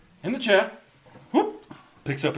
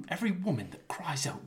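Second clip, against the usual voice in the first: whisper; 5 semitones higher, 6 dB quieter than usual. Acted. Dramatic monologue, whispered.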